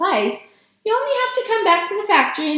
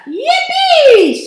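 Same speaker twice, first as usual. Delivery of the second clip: high, loud, drawn-out whoop; gleeful holler; joyful shout; 10 dB louder than usual. A narrator's reading. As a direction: whoop it out in delight. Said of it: "shouted Grandpa Joe".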